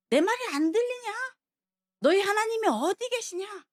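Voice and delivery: high, sneering jeer; mock them as if they can't hear; mocking taunt. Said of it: "조롱하는 거예요".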